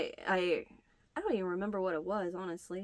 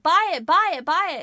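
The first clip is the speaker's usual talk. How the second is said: loud, higher buy it chant; urging; 8 semitones higher, 9 dB louder than usual. Retold or imitated. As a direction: egg her on loudly. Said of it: "He's like".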